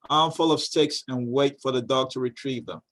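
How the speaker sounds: usual speaking voice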